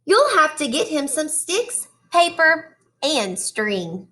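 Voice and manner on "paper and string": ordinary delivery, nothing marked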